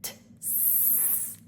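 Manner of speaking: T, s: a tap sliding into a long hiss; t into s sound. Shown as a demonstration. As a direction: turn T into a snake s. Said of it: "gradually turn it into a snake".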